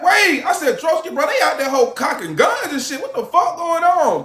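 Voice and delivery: alarmed. Retold or imitated. Retold alarm to friend.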